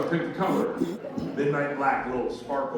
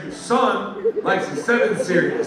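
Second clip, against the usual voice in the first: raised voice, a bit higher, for someone hard of hearing; talking louder; 6 semitones higher, 5 dB louder than usual. Acted. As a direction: speak up politely so she hears you. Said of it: "We gotta talk louder".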